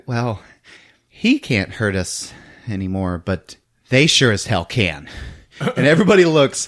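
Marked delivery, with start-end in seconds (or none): 0.1-5.1 understated grim correction; deadpan. Retold or imitated. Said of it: "And she's like".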